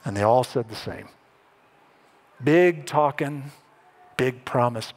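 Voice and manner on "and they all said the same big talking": ordinary delivery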